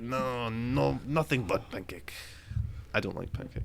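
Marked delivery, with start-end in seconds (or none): 0.0-2.1 mock accent. Continues pancake character bit.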